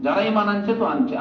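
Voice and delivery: ordinary delivery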